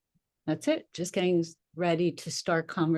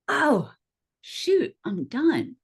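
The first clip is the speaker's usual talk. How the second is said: high, sudden dismay; a jolt of pain, then giving up; doubled over; 9 semitones higher, 4 dB louder, much slower than usual. Retold or imitated. Driver doubling over in pain, high dismayed cry. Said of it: "and went".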